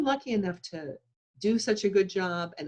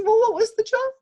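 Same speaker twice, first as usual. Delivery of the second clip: much higher, louder cabbie voice, comforting; kindly taxi driver; 7 dB louder than usual. Retold or imitated. Taxi driver consoling a crying passenger, high pitched and loud. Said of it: "He says".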